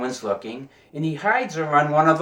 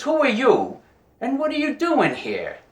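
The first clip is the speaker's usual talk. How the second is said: higher, mock-alarmed captain's demand; startled captain; 10 semitones higher than usual. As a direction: jump up in pitch like a caught-off-guard captain. Captain finds a stowaway.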